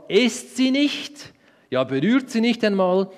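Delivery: God's command, quoted by Eva; urgent warning. Acted. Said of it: "hat Gott gesagt".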